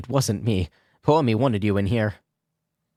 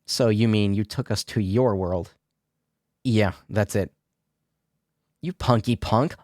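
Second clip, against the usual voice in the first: ordinary talk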